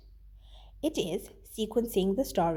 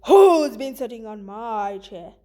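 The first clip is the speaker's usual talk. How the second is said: a louder, gruff big bear; Papa Bear; 3 semitones lower, 8 dB louder than usual. Acted. Teacher voicing Papa Bear for students, loud and gruff. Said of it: "Papa Bear says".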